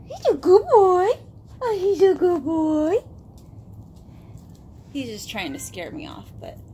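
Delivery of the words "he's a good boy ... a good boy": high, loud, drawn-out dog praise. Real, not acted. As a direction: gush slowly and loudly over a good dog.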